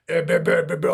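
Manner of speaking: loud mock rant of blahs; preachy ranting. Man ranting to his old lady about an article, preachy. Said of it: "all preachy about what".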